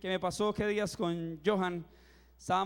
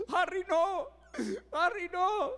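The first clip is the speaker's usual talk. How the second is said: much higher, louder, pleading cry; desperate plea; 10 semitones higher, 4 dB louder, slower than usual. Retold or imitated. Movie daughter calling to her father: high, pleading cry. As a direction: beg him not to go, voice up high. Movie character begs the hero.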